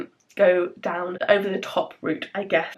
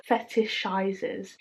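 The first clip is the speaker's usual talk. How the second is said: very slow, careful "Fetishizes", softer; careful attempt; 5 dB quieter, much slower than usual. Real, not acted. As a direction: sound out "Fetishizes" syllable by syllable. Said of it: "why can I not say it?".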